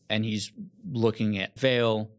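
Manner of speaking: normal talking voice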